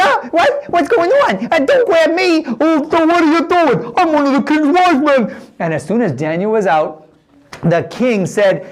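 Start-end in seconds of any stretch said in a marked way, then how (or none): 0.0-5.4 much higher, flustered protest; high, alarmed courtiers being seized; panicked wise men. Acted. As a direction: squawk as the soldiers grab you.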